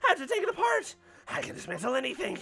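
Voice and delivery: high, laughing, unhinged rambling; frantic breakdown. Acted. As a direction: spiral into panicked madness. Villain's frantic breakdown dismantling bomb.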